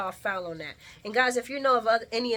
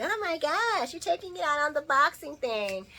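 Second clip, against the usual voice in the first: high-pitched mock gushing voice; imitating Jordan; 6 semitones higher than usual. Voicing Jordan walking in on him, high-pitched. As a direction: lift it high for a mock exclamation. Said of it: "She's like".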